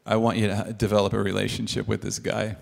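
low, calm voice of God. Retold or imitated. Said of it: "God says".

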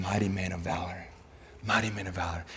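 soft, low, hushed affirmation; God's quiet voice. Acted. God's voice reaching Gideon, quiet affirmation. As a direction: say it gently low, letting it sink in. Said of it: "he heard the voice of God saying".

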